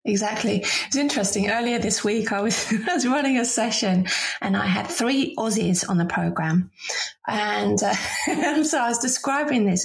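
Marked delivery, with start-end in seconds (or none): none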